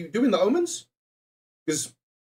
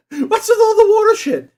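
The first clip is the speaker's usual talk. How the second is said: much higher, louder, laughing mock outrage; squeaky laughing outrage; 7 dB louder than usual. Real, not acted. Streamer repeating the water complaint to chat, squeaking with laughter. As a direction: squeal it through a laugh, half joking.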